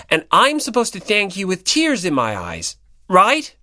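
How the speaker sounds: loud, high, indignant rant; heated accusation. Acted. Hinata accusing Komaeda of stealing the good part, loud and indignant. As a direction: call out his scheme, fired up.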